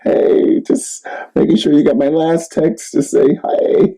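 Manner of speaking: high, laughing imitation of a follow-up text; mock-casual message said through laughter. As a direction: giggle through a sheepish check-in. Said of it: "I'm laughing because I've done this".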